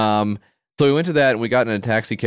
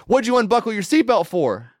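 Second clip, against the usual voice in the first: much higher, squeaky accusing question; mock suspicious attendant; 12 semitones higher than usual. Attendant to the driver who leaned over, high mock suspicion. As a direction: caricature the attendant as shrill and paranoid. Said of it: "the parking attendant's like".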